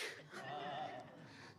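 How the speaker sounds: breathy hushed chuckle; small whispered laugh, falling away; soft whispered laugh. Retold or imitated. Owner laughs.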